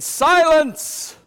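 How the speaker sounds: loud and high, a sharp command; commanding shout. Acted. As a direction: order the wind to be silent. Jesus commands the storm.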